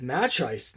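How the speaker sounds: Match Ice said as two English words, "match" and "ice"; Match Ice for Machiste, no final "ay" sound; incorrect pronunciation. Real, not acted. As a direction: say Match Ice with a hard "ch" and a long i. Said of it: "continue to pronounce it incorrectly like that".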